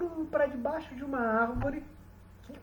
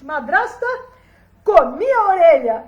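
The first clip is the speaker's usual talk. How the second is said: put-on boy proudly calling out; much louder, triumphant declaration; loud announcement; 3 semitones higher, 13 dB louder than usual. A narrator's reading. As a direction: belt it out to someone across the kitchen. Boy announces loudly to stepmother.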